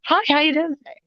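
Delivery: high, bright telemarketer hello; telemarketer-style phone hello to a stranger; cheery phone greeting. Retold or imitated. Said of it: "I'd be like".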